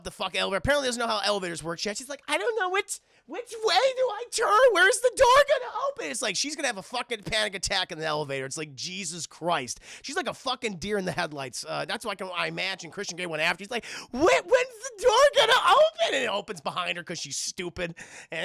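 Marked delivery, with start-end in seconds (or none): 2.3-6.1 high squeaky panicked voice, much louder; hammed-up nervous girl lost in an elevator; mock panic. Mocking Annie's panic in elevator. 14.1-16.1 very high, loud whiny panic; hammed-up helpless freak-out over a door; mock panic.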